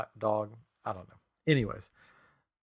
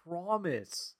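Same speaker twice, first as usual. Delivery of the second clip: soft, slow, higher-pitched plea; earnest insisting; 5 semitones higher, 8 dB quieter, slower than usual. Retold or imitated. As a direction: draw it out to swear it is true. Retold pleading with doubting wife.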